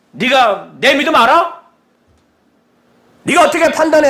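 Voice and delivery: mock a touchy believer snapping back; indignant retort. How we would answer Jesus, indignant. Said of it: "우리 같으면은".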